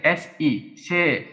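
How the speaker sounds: ordinary talk, nothing marked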